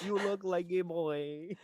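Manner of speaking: laughing Filipino accent imitation. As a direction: echo the line in a Filipino accent. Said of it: "in a Filipino accent come out just now".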